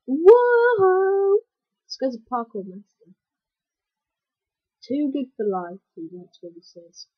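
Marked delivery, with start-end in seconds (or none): 0.1-1.4 loud high drawn-out whoa; long amazed whoa. Real, not acted. Character flies.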